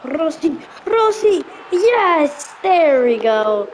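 rising yell of a name, then a cheer; excited cheering. Real, not acted. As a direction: shout the name as he scores. Cheering his player scoring.

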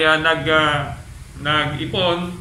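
ordinary delivery

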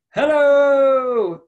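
be the echo from far away; a distant echo calling back hello. A narrator's reading. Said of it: "I heard a voice call back".

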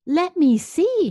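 high, loud butler voice. A narrator's reading. Butler asking to look in the mouth, high and loud. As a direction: pipe up sharply as the butler.

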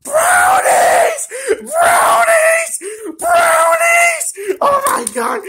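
very high, loud shriek of joy; ecstatic screaming. Acted. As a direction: scream each word, stretched out, overjoyed. Screams on finding the brownies.

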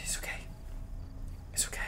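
barely audible whispered reassurance; self-soothing whisper. Real, not acted. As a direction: whisper to calm yourself down. Calming self while hiding.

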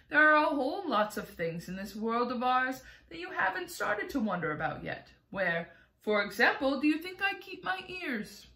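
ordinary delivery

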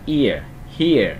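"ear, here" with the two vowels glided into one syllable; nối âm. Shown as a demonstration. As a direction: say "ear" and "here" each as one joined, falling sound. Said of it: "Mình sẽ nối lại". (đọc "ear, here" nối liền thành một âm)

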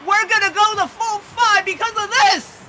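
excited shout. Real, not acted. Co-caster reacts to game winner.

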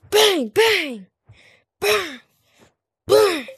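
high loud mock gunshots; gun noises. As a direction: fire off each bang. Pretend shooting.

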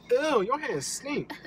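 high, slow disgusted ew. Real, not acted. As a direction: pull back in disgust. Disgusted at the smell.

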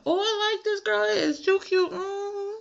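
put-on very high, squealy girly voice; girly squeal. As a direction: squeal like a delighted girl. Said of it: "I was like".

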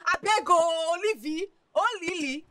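high, loud, drawn-out begging; wailing plea. Acted. Friend begging Olivia to be sharp about money, wailing plea. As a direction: cry out and implore, stretching the name.

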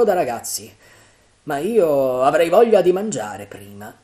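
loud, jaunty character voice, pitch climbing; confident pirate chief. A narrator's reading. Silver telling his men the treasure is child's play, confident and loud. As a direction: project like a swaggering leader.

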